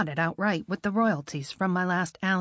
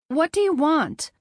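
wary challenge; 6 semitones higher, 5 dB louder than usual. Acted. Woman challenges stranger at door.

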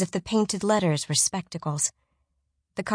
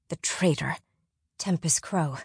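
whispered; 4 dB quieter than usual. A narrator's reading. Naming the traitor darkly.

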